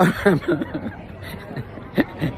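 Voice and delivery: breathy, wheezy giggle; giggling. Real, not acted. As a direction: crack up at your own bit. Laughs at own mock bravery.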